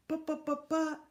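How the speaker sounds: high stammer. Acted. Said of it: "stammered Mister Klutz".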